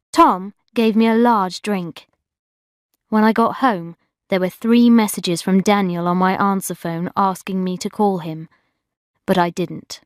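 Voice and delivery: usual speaking voice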